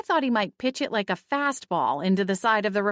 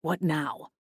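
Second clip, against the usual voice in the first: grunted; 2 semitones lower, 4 dB quieter than usual. Acted. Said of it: "a grunted".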